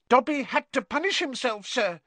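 high squeaky house elf; high-pitched creature. A narrator's reading. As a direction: explain meekly in a high elf voice. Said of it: "in a high-pitched voice".

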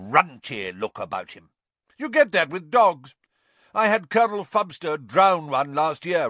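high, loud, contemptuous judgment; scornful aunt sizing up the boy; sneering Aunt Marge. A narrator's reading. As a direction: look down on him like a runt dog.

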